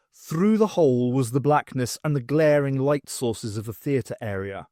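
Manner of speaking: ordinary delivery, nothing marked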